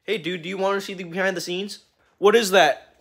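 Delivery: ordinary talk, nothing marked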